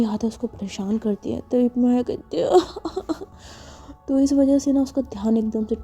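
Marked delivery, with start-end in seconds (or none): 2.6-3.2 high soft giggle; a silly little laugh; Maya's playful giggle; childish giggle. Acted. Maya laughs mid-scene.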